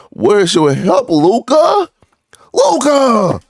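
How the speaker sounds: high, loud calls of Luka, fed up; exasperated yelling. Real, not acted. Fan yelling at Luka through the TV, exasperated shout. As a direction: shout at the screen like he can hear you.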